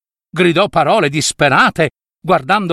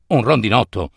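low take; 6 semitones lower than usual. A narrator's reading. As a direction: drop down low on "Un rondinotto!". Takes of the same word.

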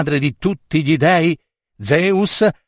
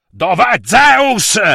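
slow, raised shout that climbs high; 7 semitones higher, 4 dB louder, much slower than usual. A narrator's reading. River god demanding Zeus from his daughter, shouting. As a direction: roar the question, stretching the name. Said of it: "Il dio fiume Asopo gridò".

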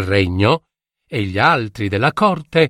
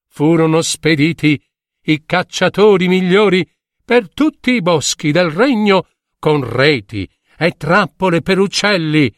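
ordinary delivery, nothing marked

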